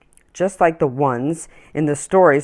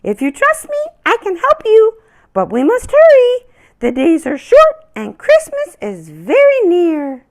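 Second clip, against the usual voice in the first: much higher, louder birdlike reassurance; cheerful little bird; 8 dB louder than usual. Acted. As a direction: chirp it back like a friendly bird. Red bird urging Anja on.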